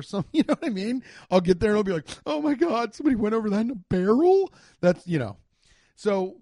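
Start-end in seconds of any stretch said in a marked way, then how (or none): 2.3-4.5 high, fast gasp of wonder at the falls; imagined future self, gushing; mock amazement. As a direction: play the wide-eyed tourist stunned by a barrel story.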